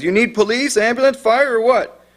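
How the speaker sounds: high, impatient string of questions; imitated impatient dispatcher. Dispatcher cuts off the confused caller, imitated impatience. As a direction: cut in with high-pitched, pressing questions. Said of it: "the dispatcher cuts him off and says".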